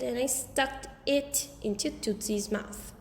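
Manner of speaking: ordinary delivery, nothing marked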